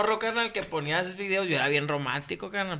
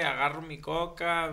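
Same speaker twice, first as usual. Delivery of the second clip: usual speaking voice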